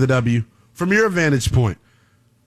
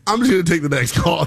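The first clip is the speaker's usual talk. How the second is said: normal talking voice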